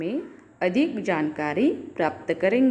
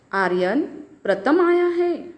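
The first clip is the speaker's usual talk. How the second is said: exclaiming; 4 semitones higher than usual. Shown as a demonstration. Teacher reading the third sentence to children, exclaiming. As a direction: pause after Aryan, then high exclaimed "प्रथम आया है". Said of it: "अलग-अलग भाव स्पष्ट".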